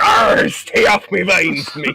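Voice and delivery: loud, slow, high pirate growl of arr; grizzled pirate. Pretend. Friend imagines an Australian pirate winemaker, shouting slowly. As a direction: ham up an old pirate guarding his vines. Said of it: "a former grizzled pirate".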